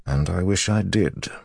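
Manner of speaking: ordinary delivery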